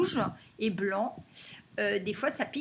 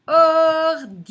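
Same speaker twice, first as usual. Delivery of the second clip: ordi chanted high and loud with a long i; loud, high or-diii; stretched second syllable; 6 semitones higher, 12 dB louder than usual. Shown as a demonstration. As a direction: hold or, then diii in ordi. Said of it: "on entend le I dans la deuxième".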